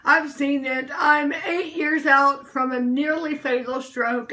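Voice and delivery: ordinary talk, nothing marked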